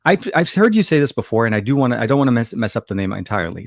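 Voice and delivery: ordinary talk, nothing marked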